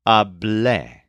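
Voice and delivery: "hablé" said ab-LEH, stress on the final lé; "hablé" with the weight on the last syllable, lé; stress on second syllable. Shown as a demonstration. Teacher modelling "hablé" for learners, stress on lé. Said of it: "with a stress on the second syllable".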